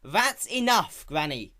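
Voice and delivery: slow, high, loud and firm. A narrator's reading. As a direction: end the argument. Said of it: "Applejack said firmly".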